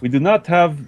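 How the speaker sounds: hammed-up Indian salesman; Indian accent. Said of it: "Oh my God, he's Indian".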